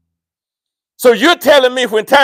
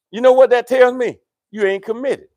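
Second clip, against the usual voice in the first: normal talking voice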